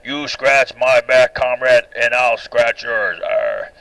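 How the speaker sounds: slow, lightly voiced Russian bear with a growl; a hint of a gruff bear, drawn out slowly; mildly hammed-up bear voice ending in a growl. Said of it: "the bear says".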